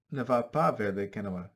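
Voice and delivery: ordinary delivery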